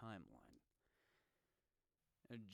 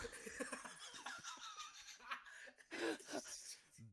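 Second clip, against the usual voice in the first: very high squealing laugh; high, wheezy laughing fit; helpless laughter; 5 dB quieter than usual. Real, not acted. Laughing fit at own slip.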